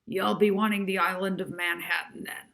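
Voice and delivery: put-on a light Brooklyn accent, brisk; a hint of a Brooklyn cab attendant. A narrator's reading. Cab rank attendant asks Molly, Brooklyn accent. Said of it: "with a thick Brooklyn accent".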